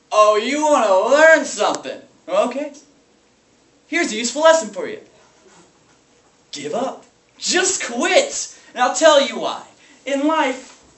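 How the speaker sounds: high, loud bursts of bitter teaching; raging rant. Acted. Substitute teacher lashing out at small private school children, raging rant. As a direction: lash out at the class in a high, heated voice. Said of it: "lashing out his rage".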